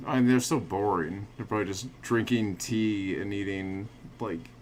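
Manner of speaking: normal talking voice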